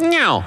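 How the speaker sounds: high mewing cow elk call; cow call imitation. Caller imitating his cow call to move the bull, high mew. So I went.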